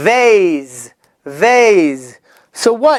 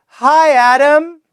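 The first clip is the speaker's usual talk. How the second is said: modelled class reply; 8 semitones higher than usual. Shown as a demonstration. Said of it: "So everybody say".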